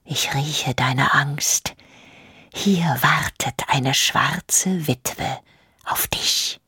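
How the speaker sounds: unvoiced menacing whisper; queen whispering to herself about Hagen; inaudible whisper. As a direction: breathe the words to yourself. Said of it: "flüsterte sie unhörbar".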